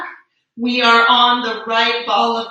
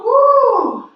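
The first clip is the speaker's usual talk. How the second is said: high, loud "woo" sliding down; long, high whoop of release; whooping exhale; 11 semitones higher, 5 dB louder than usual. Real, not acted. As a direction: let the breath go in a big woo. Letting go after hard pose.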